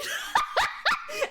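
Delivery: high shrieking laugh. Real, not acted. Shrieking laugh after line.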